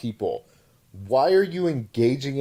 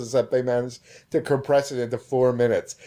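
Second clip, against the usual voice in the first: ordinary talk, nothing marked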